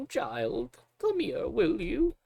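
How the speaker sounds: put-on high, slow kindly old woman; old cook voice. Old cook Magda calling the child to her, high and slow. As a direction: croon it like a sweet old kitchen matron. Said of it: "That was awful".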